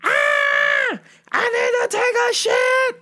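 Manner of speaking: high screaming demand, imitated; scream, then shriek the demand; yelling at top of lungs. Customer demanding a toilet, mock top-of-lungs yelling. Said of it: "This bitch was yelling at the top of her lungs".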